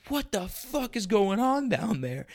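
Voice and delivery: high, slow, incredulous outburst; retold astonishment. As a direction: draw out the baffled disbelief. Acted shock at screaming lifter.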